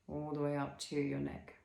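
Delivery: normal talking voice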